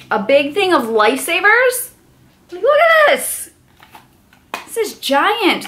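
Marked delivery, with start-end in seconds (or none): 2.5-3.5 high squeal of delight; high excited look at this. Real, not acted. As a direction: shriek it up high. Unboxing gifts, delighted.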